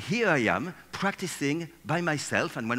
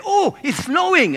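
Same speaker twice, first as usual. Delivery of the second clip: much higher and louder, a sudden delighted cry; excited shout; 14 dB louder than usual. Acted. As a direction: shout it to make the whole room turn. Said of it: "I would say".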